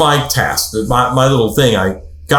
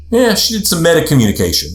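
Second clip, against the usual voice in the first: ordinary talk, nothing marked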